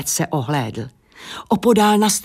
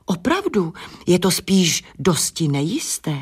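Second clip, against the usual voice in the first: plain reading voice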